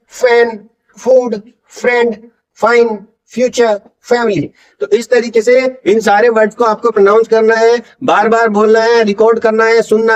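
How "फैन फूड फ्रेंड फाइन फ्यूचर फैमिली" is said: फैन, फूड, फ्रेंड slow and separate, each F distinct; F sound drill. Shown as a demonstration. Teacher leading repeat drill, slow फैन to फैमिली. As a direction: space out each word on a clear F. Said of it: "एफ से जो sound आएंगे".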